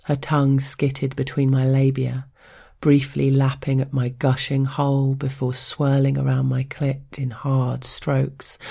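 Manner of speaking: usual speaking voice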